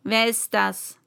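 a hint of a stern queen; lightly voiced stern queen asking. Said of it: "fragte strenge".

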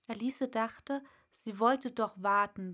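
plain reading voice

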